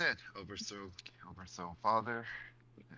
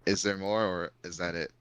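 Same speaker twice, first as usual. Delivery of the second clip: Is there more repeated much louder so it carries; 10 dB louder than usual. Real, not acted. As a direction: raise the voice so the far listener hears. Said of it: "You are too far away".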